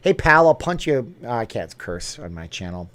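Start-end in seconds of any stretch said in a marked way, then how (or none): 0.0-1.1 louder, higher mock Boston threat; mock Boston tough. People from Boston warning a bad imitator, mock Boston accent. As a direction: play an offended Boston local. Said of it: "people from Boston will be like".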